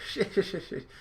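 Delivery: high, loud anime hero; Luffy voice. Acted. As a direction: blurt it out like a cartoon boy. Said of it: "Luffy's like".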